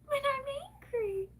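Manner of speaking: hint of a mocking man's voice; mocking quote. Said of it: "He sat there and said".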